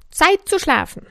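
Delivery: high, loud command; Anger's voice. A narrator's reading. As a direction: order everyone to bed as Anger. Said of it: "sagte die Wut".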